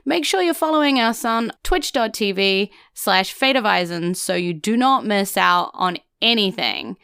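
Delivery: ordinary talk, nothing marked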